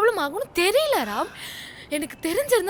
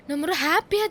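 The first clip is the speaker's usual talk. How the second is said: usual speaking voice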